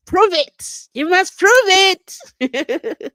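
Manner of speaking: loud, high prove it, dragged out slow; hammed-up lawyer challenge; playful booming challenge; mock courtroom demand.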